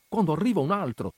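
ordinary delivery

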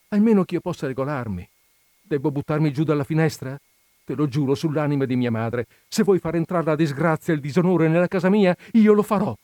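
building from plea to a high, loud oath; desperate crescendo. Acted. Woman threatens to jump, swearing. (giuramento disperato, in crescendo fino al grido)

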